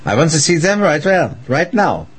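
demanding. Acted. Hitler demands witches now.

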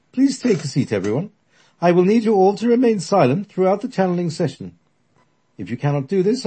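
high, loud commanding medium; authoritative old lady. Acted. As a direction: take charge of the room. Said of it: "with a loud, authoritative voice".

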